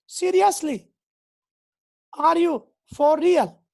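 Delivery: seriously, for real, in open disbelief. Shown as a demonstration. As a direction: say it like you can't believe your ears. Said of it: "alternative questions".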